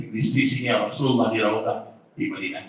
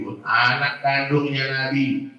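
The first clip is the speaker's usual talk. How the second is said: usual speaking voice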